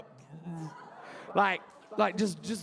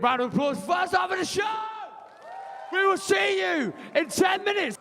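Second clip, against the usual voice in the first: loud, high crowd-hyping call; hyped MC call to the audience; MC shout; 8 semitones higher, 4 dB louder than usual. Real, not acted. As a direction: whip the crowd up.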